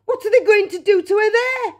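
high, loud, alarmed question; horrified cry. A narrator's reading. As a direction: cry out as a frightened mother. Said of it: "cried Missus Beauregard".

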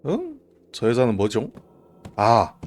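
ordinary delivery

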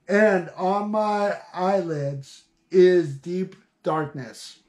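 slow, solemn reading of Job; scripture reading. Acted. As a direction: read the verses slowly and gravely. Reads scripture aloud slowly.

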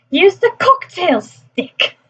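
high, drawn-out advert quote; imitated TV advert line. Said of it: "the one with Gordon Ramsay and those two people in the shop".

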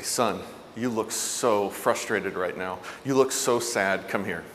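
soft, slow, low and tender; hushed, sympathetic parent's voice; soothing parent. Acted. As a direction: comfort an upset child gently. Models a parent soothing upset child.

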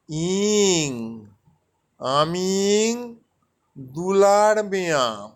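আমি drawn out very slowly, then দুলার Mia said clearly; teacher spelling out the Santali words slowly; slow Santali drill. Shown as a demonstration. Teacher drills learners on a Santali phrase, very slow. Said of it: "একটা বাক্য মুখস্থ করুন".

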